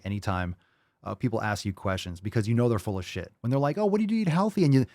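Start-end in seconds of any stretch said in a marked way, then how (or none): 3.8-4.6 high-pitched mimicry of gushing curiosity; higher mock-eager question; fake-interested asker. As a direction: imitate someone feigning interest. Mimics nosy acquaintances asking.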